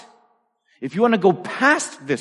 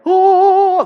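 a high, loud gasp of wonder; the world suddenly comes into focus; awed oh; 7 dB louder than usual. Retold or imitated. Boy seeing the chalkboard clearly, a high, loud awed oh. First sight through new glasses.

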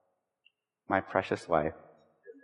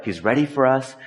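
ordinary talk

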